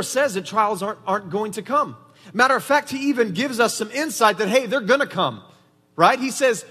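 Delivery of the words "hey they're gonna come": normal talking voice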